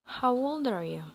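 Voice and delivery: ordinary delivery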